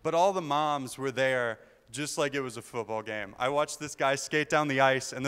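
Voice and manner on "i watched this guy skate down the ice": ordinary talk, nothing marked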